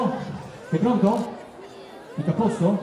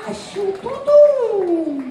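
voce impostata; 9 semitones higher, 4 dB louder, much slower than usual. Acted. Comico fa il mago, voce impostata.

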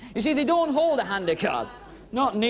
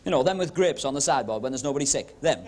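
posh voice; 6 semitones lower than usual. Retold or imitated. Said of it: "the ones who talk like that".

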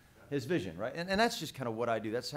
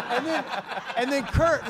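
talking through loud laughter; laughing; 8 semitones higher, 8 dB louder than usual. Real, not acted. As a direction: laugh your way through the words. Laughing while retelling the story.

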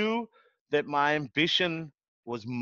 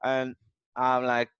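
normal talking voice

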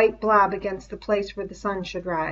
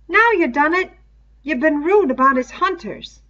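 reproachful Piggy; 8 semitones higher, 6 dB louder than usual. Acted. Piggy scolding Ralph.

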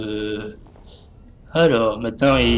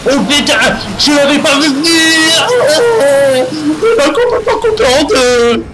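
shocked scream; 12 semitones higher, 4 dB louder than usual. Real, not acted. Killed by chainsaw killer, screaming.

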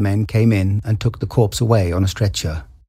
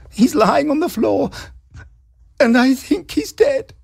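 high, slow, tearful; sobbing. A narrator's reading. Wife reporting husband's death, high slow sobbing. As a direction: let it break with grief, slowly. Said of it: "she sobbed".

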